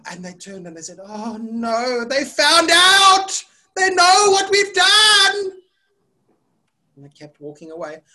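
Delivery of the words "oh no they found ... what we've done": slow wail climbing high and loud; hammed-up kid's panic; mock despair. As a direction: build from dismay to a drawn-out cry. Children panic at being found.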